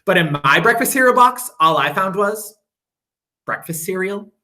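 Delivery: usual speaking voice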